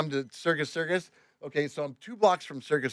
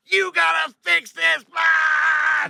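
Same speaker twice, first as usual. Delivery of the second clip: slow, high, drawn-out yell; imitated screaming; 13 semitones higher, 7 dB louder, much slower than usual. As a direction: scream it like a furious customer. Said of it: "She started screaming".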